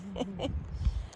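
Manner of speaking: short breathless laugh after climbing; worn-out chuckle; tired laugh. Real, not acted.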